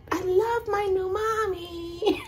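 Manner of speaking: high, slow little puppy voice. Pretend. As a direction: speak for the dog, tiny and sweet. Voicing the puppy.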